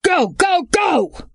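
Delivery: high urgent shout to the driver; hyper shout. A narrator's reading. Kidnapper yelling at the driver to go. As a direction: yell it, frantic, get moving. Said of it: "Hyperguy's voice shouted at the driver".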